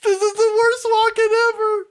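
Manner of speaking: high, laughing mock outrage; laughing disbelief. Real, not acted. Co-host panning a terrible Walken, laughing disbelief. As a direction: crack up while panning it. Co-host cracking up at bad impression.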